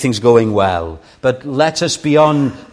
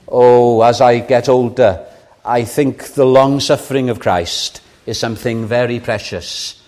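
usual speaking voice

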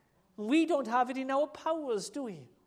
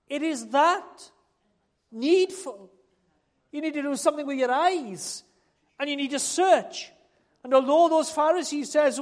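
raised, urgent pulpit voice; insistent preacher building in force; forceful preaching; 5 semitones higher, 7 dB louder than usual. Acted. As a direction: press it on the congregation with growing volume.

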